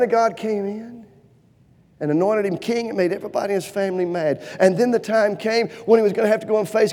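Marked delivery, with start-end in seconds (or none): none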